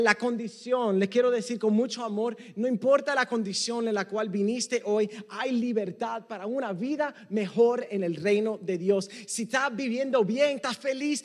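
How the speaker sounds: ordinary talk, nothing marked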